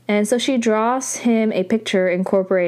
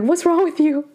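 pitched-up, acted-out exasperation; mock indignant retort; 7 semitones higher than usual. Aro answering angry Sam, mock indignant. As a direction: fire it back in mock outrage. Retelling Aro's retort in quarrel.

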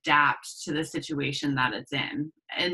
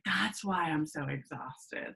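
much softer, as if to oneself; retold weary realization; 9 dB quieter than usual. Speaker recalling tapering off adaptogens, soft weary realization. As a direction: drop the volume for a tired realization.

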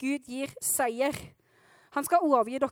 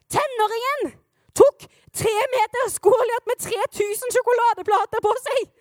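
high, loud disbelief; stunned, pitched way up and forceful; incredulous outburst; 7 semitones higher, 5 dB louder than usual. Acted. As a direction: shout it as if you cannot believe it. Preacher acts stunned at the outcome.

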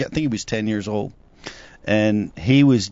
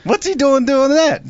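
high, louder disbelieving question; mock incredulity; 13 semitones higher, 4 dB louder than usual. Complaining to friends about Brian, high mock disbelief. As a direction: throw up your hands at him.

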